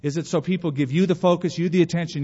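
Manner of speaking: usual speaking voice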